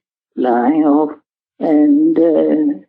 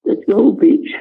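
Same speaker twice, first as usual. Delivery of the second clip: ordinary delivery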